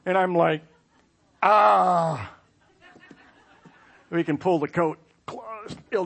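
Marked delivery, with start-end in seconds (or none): 1.4-2.3 dismayed cry. Retold or imitated. Dressing lands on him.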